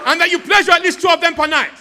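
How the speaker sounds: loud, high mock African accent. Comic pressing the volunteer in an African accent. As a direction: bellow the question in a put-on African accent. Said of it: "in an African accent".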